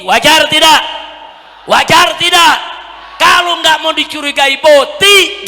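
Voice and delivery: loud, high rally shout; full-force preaching. Real, not acted. Preacher challenging the congregation, full-force shouting. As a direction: drive the point home to a big crowd.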